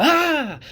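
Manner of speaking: a jolt of pain bursts out as a high yelp; sudden yelp. Retold or imitated. Rand, pinched on the buttocks, lets out a high loud yelp. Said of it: "And he yells".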